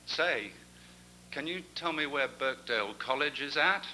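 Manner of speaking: a lightly voiced American accent. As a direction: attempt an American tourist asking directions. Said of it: "I can't do this accent either".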